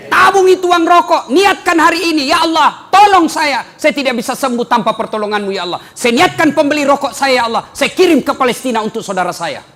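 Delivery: loud, high preaching of a prayer; fiery sermon prayer; fervent prayer. Acted. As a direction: lead the crowd in an impassioned vow. Leads intention prayer loudly.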